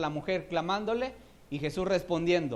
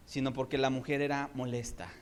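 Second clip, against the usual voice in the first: ordinary talk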